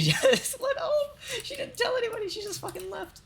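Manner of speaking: words broken up by high laughter; laughing through the punchline. Real, not acted. Laughing while telling her friend she just went home.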